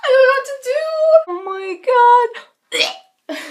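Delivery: high, loud, wailing half-sung cry; near tears. Real, not acted. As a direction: wail it like you're about to burst into tears. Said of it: "three seconds from crying".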